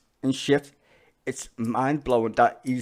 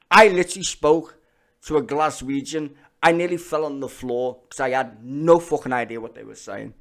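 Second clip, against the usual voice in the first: ordinary delivery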